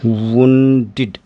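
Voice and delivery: Wounded drawn out slowly, ending in 'id'; teacher's slow demo of wounded; slow model pronunciation. Shown as a demonstration. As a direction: spell out wounded slowly for students. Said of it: "अब इसका pronunciation क्या हो जाएगा?".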